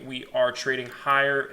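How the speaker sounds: normal talking voice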